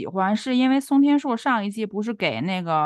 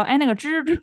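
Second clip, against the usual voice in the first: put-on 蜘蛛 said in a broad Beijing accent, with the raised pitch of a quoted call; tu Beijing way of saying 哎，那个蜘蛛; mock tu Beijing accent; imitating own Beijing accent; 6 semitones higher than usual. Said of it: "我就会说".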